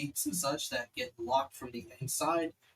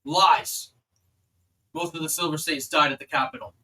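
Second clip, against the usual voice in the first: loud, forceful guard's rebuttal; raised, commanding voice of a disbelieving sentry; loud accusation; 3 semitones higher, 9 dB louder than usual. Acted. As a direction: call out the accusation from the wall. Guard accuses strangers from the wall.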